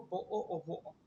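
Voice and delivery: "वो ओ ओ वो ओ": bottle of water with glottal stops for Ts; British accent, drop each T into a glottal catch. Shown as a demonstration. Teacher showing class British bottle of water, T's silent. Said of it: "इसी तरीके से वो बेचारे भी ऐसा ही करते हैं".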